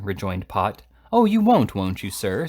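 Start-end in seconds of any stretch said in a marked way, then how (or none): 1.1-2.5 high, loud mocking jab; taunting rival editor. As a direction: goad him with a sneer. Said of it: "said Mister Slurk in a taunting manner".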